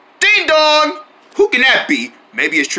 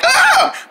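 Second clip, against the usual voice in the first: loud scream demo. Shown as a demonstration. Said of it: "I want you to scream, like, really loud".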